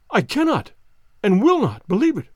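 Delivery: I cannot stressed high and loud; cried out. Acted. Banker refuses Holmes's news, crying out. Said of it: "cried the banker".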